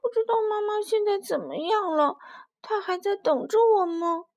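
high wailing little-dinosaur voice; tearful crying. A narrator's reading. Little dinosaur worrying about mother far away, crying as it speaks. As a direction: wail it like a weeping child. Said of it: "臭臭哒哒哭着说".

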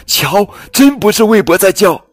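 high, loud bird voice; excited little bird. Acted. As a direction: point it out, amazed. 小鸟惊讶指出. (高声惊讶的小鸟)

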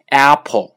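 Apple with a wide-open first vowel; exaggerated Apple. Shown as a demonstration. Teacher repeating the wide-mouthed Apple. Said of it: "这个嘴张的大一点".

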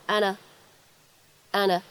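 "and a" run together as "anna"; slow linked "and a"; linked reading. Shown as a demonstration. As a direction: link the d of "and" into "a". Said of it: "可以读成and".

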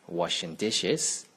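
ordinary delivery

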